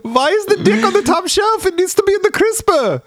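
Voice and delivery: squeaky-high put-on indignation; mock scolding character. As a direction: play a fussy fridge owner in a silly high voice. Riffs as fridge's fussy owner.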